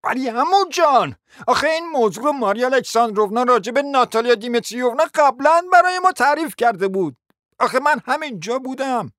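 high, insistent rush of explanation; protesting nephew. Acted. Nephew contradicting his uncle with what he overheard, protesting insistently.

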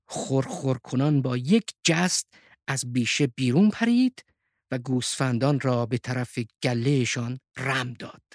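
plain reading voice

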